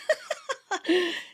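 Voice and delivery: scornful laugh; a wife laughing at her husband; wife's mocking laugh. A narrator's reading. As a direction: laugh at him. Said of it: "Kathleen laughed".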